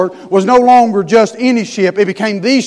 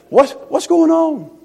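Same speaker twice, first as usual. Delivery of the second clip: gentle high slow question; 8 semitones higher, slower than usual. Acted. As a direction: ask it gently and slowly. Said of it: "That's in the Greek".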